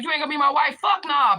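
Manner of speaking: loud, high indignant refusal; retold outburst.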